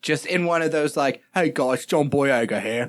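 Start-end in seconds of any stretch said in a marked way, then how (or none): 1.3-2.9 louder British accent impression of John Boyega. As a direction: put on a poor British accent as the actor. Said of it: "It's not how John Boyega sounds".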